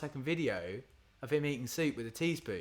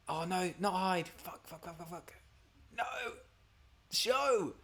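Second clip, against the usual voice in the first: mock panic; 7 semitones higher than usual. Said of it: "being like".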